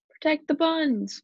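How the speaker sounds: high, drawn-out battle cry; rally them, stretching the words; out-of-control cry. A narrator's reading. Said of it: "Miss Dole was out of control".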